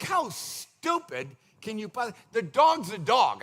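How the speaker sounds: exasperated scoff. Retold or imitated. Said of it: "I am like".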